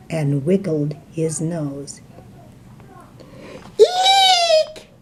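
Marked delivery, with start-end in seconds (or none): 3.8-4.9 very high frightened shriek; scared shriek. Acted. The elephant spots the mouse, a shrieking eek. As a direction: shriek in terror. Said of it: "shouted the elephant".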